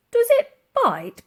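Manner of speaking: high, loud, slow nervous question; old soldier wary of the mammoth. A narrator's reading. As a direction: ask it warily from a distance. Another old soldier asks.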